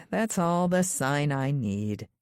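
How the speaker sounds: usual speaking voice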